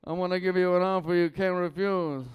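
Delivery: hammed-up mafia don selling internet bundles; Don Corleone. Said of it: "this is my impression of Don Corleone".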